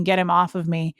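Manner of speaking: usual speaking voice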